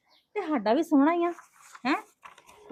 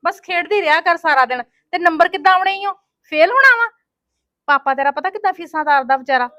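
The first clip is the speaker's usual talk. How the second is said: louder and higher, building scold; angry scolding; 5 semitones higher, 9 dB louder than usual. Acted. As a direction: tear into the lazy child. ਮਾਂ ਬੱਚੀ ਨੂੰ ਡਾਂਟਦੀ. (ਵਧਦੇ ਗੁੱਸੇ ਵਿੱਚ ਡਾਂਟ)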